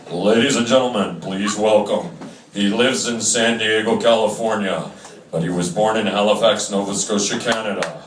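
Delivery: booming arena announcer; a hammed-up stadium PA announcement. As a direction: announce it to a stadium crowd with echo.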